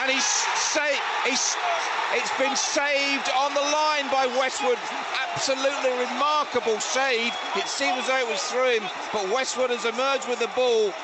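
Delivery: real excitement at a stoppage-time penalty save; excited shout. Real, not acted. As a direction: yell the save as it happens. Live penalty save commentary.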